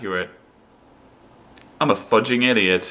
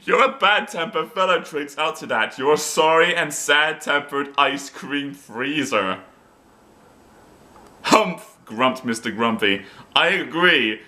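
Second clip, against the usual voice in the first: hammed-up storytime reading with character voices; comic narrator of a translated children's book; story reading; 4 semitones higher than usual. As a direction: read it like a silly bedtime story. Reads Mister Grumpy as story time.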